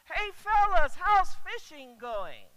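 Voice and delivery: loud friendly shout across the water; cheery call from shore. Acted. Stranger on shore calling to tired fishermen, loud and cheery. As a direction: hail them like a breezy stranger.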